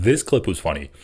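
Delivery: normal talking voice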